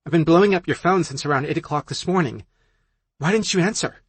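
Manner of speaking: high, loud scolding friend voice; annoyed, demanding answers; exasperated friend. Acted.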